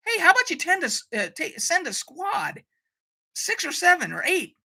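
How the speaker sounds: high-pitched, incredulous advice; exasperated suggestion. Acted. Fan telling the away team to send a squad, exasperated suggestion. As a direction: pitch it high, as if pointing out the obvious. Acted suggestion to the show's crew.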